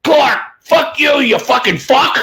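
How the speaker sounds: put-on a ten-year-old swearing, high pitched; kid cursing. Kid in the stands cursing at Clark, high kid voice. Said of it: "all I hear from this one kid is".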